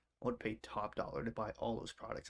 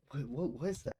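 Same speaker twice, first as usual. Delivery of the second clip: usual speaking voice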